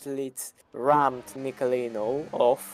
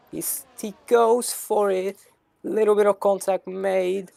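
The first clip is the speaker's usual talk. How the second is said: excited race call; 6 semitones higher than usual. Real, not acted. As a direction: call the move as it happens. Overtake attempt called live.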